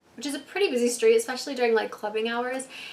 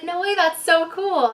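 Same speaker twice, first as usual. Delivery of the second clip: thrilled reply; 6 semitones higher, 5 dB louder, slower than usual. Retold or imitated. Thrilled reply to fan cop.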